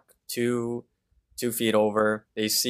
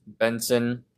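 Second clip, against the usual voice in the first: ordinary talk, nothing marked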